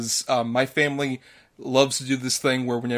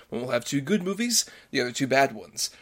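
ordinary delivery

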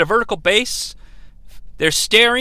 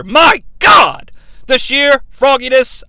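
high, loud commentator exclamation; mock-shocked outcry; 4 semitones higher, 4 dB louder than usual. Recapper reacting to another splash, mock-shocked shout. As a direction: cry out as if witnessing carnage.

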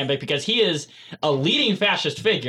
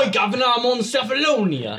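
higher, hammed-up British accent; mock British accent; 5 semitones higher than usual. Mocking John Hurt dropping his accent, broad British. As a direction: lampoon an actor slipping into British. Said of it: "drops his accent and starts speaking like normal".

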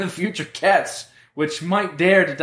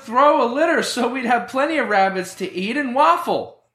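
loud; 8 semitones higher than usual. Acted. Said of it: "why are you so loud".

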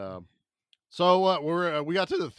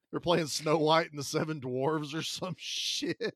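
usual speaking voice

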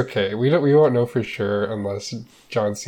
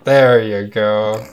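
slow host; 8 dB louder, much slower than usual. Acted.

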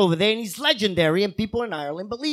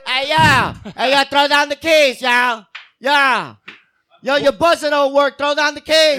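loud call up to a window; shouting up to balcony; 3 semitones higher, 6 dB louder than usual. Shown as a demonstration. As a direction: yell up to a friend upstairs. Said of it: "call a friend through a window".

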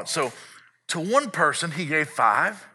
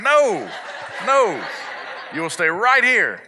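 raised, higher dad's "no"; firm refusal; 5 semitones higher, 4 dB louder, slower than usual. Acted. Dad answering his child's plea to play, firm raised refusal. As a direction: put your foot down, louder and high.

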